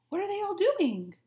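usual speaking voice

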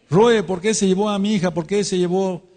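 high pleading cry of a bereaved father; grieving father pleading. Retold or imitated. Grieving parent voiced again.

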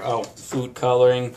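ordinary talk

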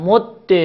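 مد slow, with doubled dal and final e; assimilated, kasra ending. Shown as a demonstration. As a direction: hold the dal of مد, then release -de. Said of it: "اگه ادغام بکنید". (مد کشیده، با دال مشدد و کسره)

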